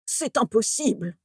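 weak, unsettled mumble; stammering dismay. A narrator's reading. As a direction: falter in mild panic. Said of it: "bredouilla Slughorn". (bredouillement désemparé)

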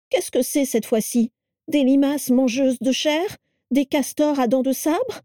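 high, complaining questions; exasperated daughter-in-law. A narrator's reading. Carla asks Vera about the parcel, exasperated. Carla dreads Vera's new animals.

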